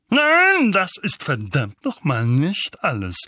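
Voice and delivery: loud bleat leaping high on the first word; Onkel Vernon, bellowing. A narrator's reading. Uncle stops Harry leaving, bellowing. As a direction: bellow an outraged objection. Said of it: "blökte Onkel Vernon".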